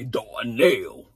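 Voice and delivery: low, drawn-out put-on name; mock fatherly drawl on Darnell. Shown as a demonstration. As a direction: say Darnell deep and stretched. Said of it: "say it the way J P say".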